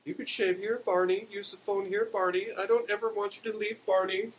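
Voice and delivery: high, insistent plea; pleading Beulah voice. A narrator's reading. Beulah begging Barney to stay, high pleading. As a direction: beg him in a high voice, pushing his name.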